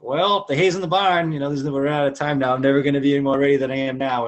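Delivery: pumped-up self talk. Retold or imitated. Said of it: "I'm like".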